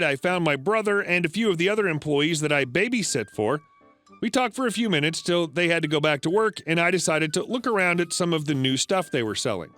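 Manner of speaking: plain reading voice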